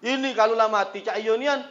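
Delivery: ordinary talk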